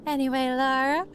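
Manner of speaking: sweet put-on service tone; customer service voice. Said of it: "my customer service voice".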